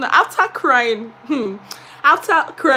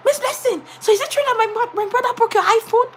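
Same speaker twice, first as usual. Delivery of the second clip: high student voice; 11 semitones higher than usual. Retold or imitated. Student voiced in a retold story.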